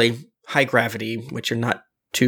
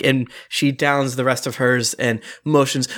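ordinary delivery

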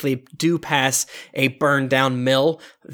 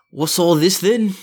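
gruff bored guard; a guard's lazy challenge; 4 semitones higher than usual. Acted. Guard voiced by GM.

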